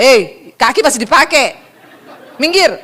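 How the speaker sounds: loud, abrupt telling-off; brusque Sulawesi person. Acted. Sulawesi person with trodden foot, loud and brusque. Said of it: "Kalau orang Sulawesi".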